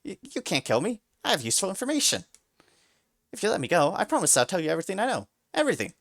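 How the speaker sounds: fast high frantic bargaining; stammering, racing to the end; panicked pleading. A narrator's reading. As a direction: gabble the promise. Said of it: "And panicking".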